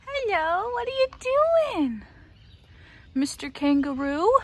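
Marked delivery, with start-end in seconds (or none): 0.0-2.0 high pet greeting. Real, not acted. Vlogger greets the approaching kangaroo, high baby talk. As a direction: sing it up to the animal.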